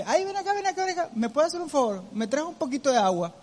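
high, pleading call from the bed; a mock sugary plea, pitched high; whiny wheedling call. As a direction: coax someone into fetching water, high and sweet. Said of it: "esa gente que ... te dice".

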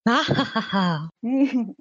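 slow villainous cackle; evil laugh. Pretend. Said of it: "Risa malvada".

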